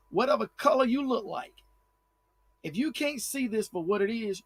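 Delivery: normal talking voice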